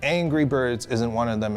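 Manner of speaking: usual speaking voice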